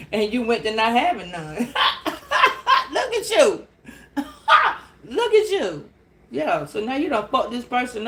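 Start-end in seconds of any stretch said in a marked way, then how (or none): 1.6-2.9 shrieking burst of laughter; high squealing laugh. Real, not acted. Reader cracking up at the cards, high squealing laugh. 2.9-5.9 high, laughing look at you. Real, not acted. Reader teasing the viewer, laughing high and loud.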